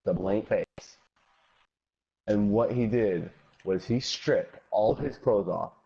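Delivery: plain reading voice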